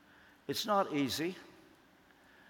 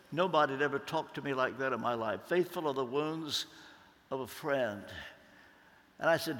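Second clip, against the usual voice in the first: usual speaking voice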